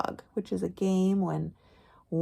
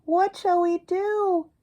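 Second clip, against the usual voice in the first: higher, louder sing-song read-aloud; higher, slower storytime chant; reading to children; 9 semitones higher, 6 dB louder, slower than usual. Acted. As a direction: chant the refrain for little listeners. Reading rhyming picture book aloud.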